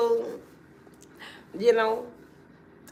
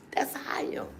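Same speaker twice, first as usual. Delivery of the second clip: whispered aside, no voice; 5 semitones higher, 8 dB quieter than usual. Real, not acted. To her viewers, a whispered confession. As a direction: breathe it out like a secret.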